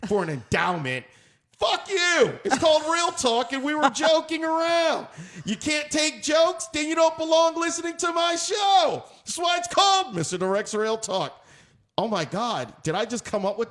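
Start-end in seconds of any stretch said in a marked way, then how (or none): 1.6-11.4 angry shouting. Real, not acted. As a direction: shout down the haters. Telling off offended viewers.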